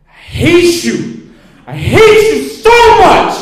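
high, very loud, slow anguished scream; tearful screaming. Retold or imitated. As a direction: scream it through tears, stretched out. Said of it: "what both of them said through tears".

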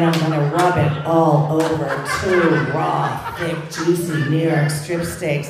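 slow, loud, laughing mock-sultry food talk. Wife teasing husband on the phone, mock-sultry. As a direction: purr it like phone-sex talk, slowly.

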